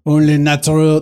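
hammed-up French accent, drawn-out words. Mimicking brother-in-law on air conditioning in France, mock French accent. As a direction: imitate him with a thick French accent. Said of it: "That's how he sounds".

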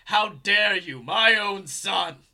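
loud, slow, outraged supervillain father; booming villain voice. Acted. Titan berating his own son over the intercom, booming villain voice. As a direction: boom it out with theatrical indignation. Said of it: "hear this loud voice booming over the intercom".

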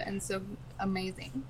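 soft breathy whisper. Real, not acted. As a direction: whisper it in awe. Gushing over tiny penguins.

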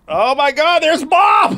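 high, loud excited shout. Retold or imitated. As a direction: yell it out in shock. Kids spotting mom, shouting.

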